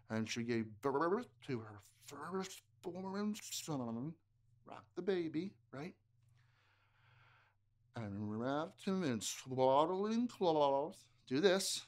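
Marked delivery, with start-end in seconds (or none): 0.1-4.1 slow, low bubbling underwater voice. Acted. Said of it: "underwater voices". 8.0-11.0 slow, low bubbling underwater voice. Acted. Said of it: "underwater voices".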